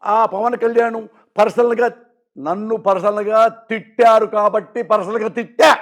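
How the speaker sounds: slow, deliberate and firm; slow it right down to hold the floor; slow, firm words to take back the floor; slow insistence. Real, not acted. Guest insists on speaking.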